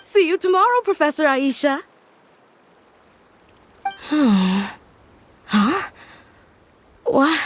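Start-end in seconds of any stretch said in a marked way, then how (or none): 3.8-4.7 sigh. Acted. Waking up tired.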